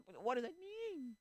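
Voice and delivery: high, slow, drawn-out plea; exasperated whine. Acted. Exasperated at fence-sitters.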